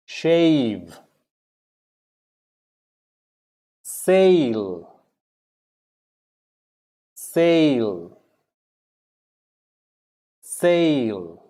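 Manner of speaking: each word alone, drawn-out long AY vowel, falling; pronunciation drill. Shown as a demonstration. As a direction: model shave, sail, wait, eight with a clear AY. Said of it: "A and E together is still A".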